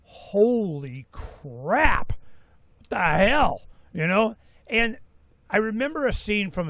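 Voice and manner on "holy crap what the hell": drawn-out, very slow "Holy crap", then a louder "what the hell"; mock astonishment at the sight; imitating amazed visitors. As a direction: play a stunned tourist at the store. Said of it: "They're like".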